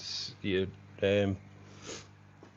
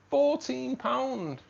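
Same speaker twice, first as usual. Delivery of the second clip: Fourteen pound dragged out slow, much higher; outraged repeat; 14 semitones higher, 5 dB louder, much slower than usual. Real, not acted. Streamer repeating Baileys price to chat, slow high disbelief.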